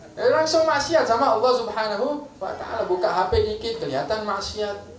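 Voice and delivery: ordinary delivery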